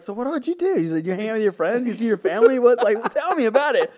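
high, sing-song mock interest; fake friendly sergeant. Drill sergeant faking interest behind the soldier, high mock friendliness. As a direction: coax him in falsely sweetly. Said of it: "He's like".

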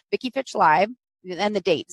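ordinary delivery

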